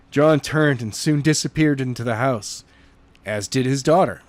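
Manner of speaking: plain reading voice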